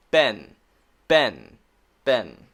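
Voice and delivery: ben with short e; example word ben. Shown as a demonstration. Teacher giving ben as short e example.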